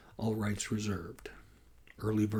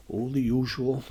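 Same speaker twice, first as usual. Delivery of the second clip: louder, higher sigh of a reply; lightly weary, accented answer; sighing, Northern European accent; 4 semitones higher, 7 dB louder than usual. A narrator's reading. Colleague listing what he missed, sighing in Nordic accent. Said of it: "Nordstrom sighed".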